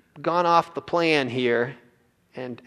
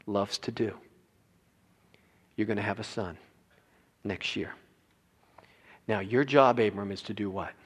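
quiet; 4 semitones lower, 4 dB quieter than usual. Acted. Quiet intimate preaching.

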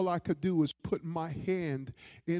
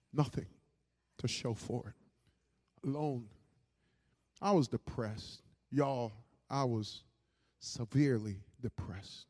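desolate confession; 5 semitones lower than usual. Real, not acted. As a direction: confess it haltingly, sunk in sadness. Confessing depression to congregation.

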